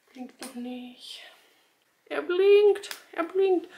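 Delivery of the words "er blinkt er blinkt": excited. Real, not acted. Test starts blinking.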